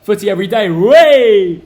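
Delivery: loud, joyful whoop; excited cheer. Real, not acted. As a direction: cheer at full volume. Celebrating football being back.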